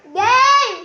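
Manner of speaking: loud shout to start the game; calling out. Real, not acted. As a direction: yell it across the house. Calling out in hide and seek.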